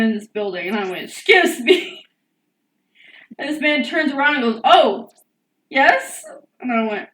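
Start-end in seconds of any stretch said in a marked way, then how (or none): 1.1-2.0 higher, louder, sharp call for attention; pointed excuse me. Retold or imitated. Customer calling to McDonald's staff, higher and louder. 4.6-6.2 higher, louder startled reply; startled worker. Retold or imitated. Worker turning to customer, higher startled yes.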